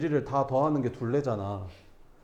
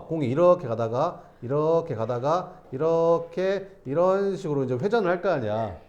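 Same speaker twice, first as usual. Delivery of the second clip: normal talking voice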